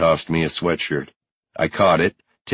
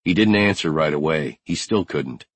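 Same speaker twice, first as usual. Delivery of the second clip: ordinary delivery, nothing marked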